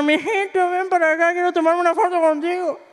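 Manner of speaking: high, coaxing voice of an older lady imitating her; playfully voicing a doting señora calling him over; señora cariñosa. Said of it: "Me dice una señora". (voz aguda de señora que lo llama)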